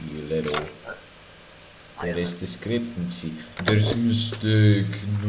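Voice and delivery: lightly Polish inspector, smug; a guard gloating over a mistake in Polish accent. Pretend. Inspector catching a traveler's mistake, mock Polish accent. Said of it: "I can do a Polish accent".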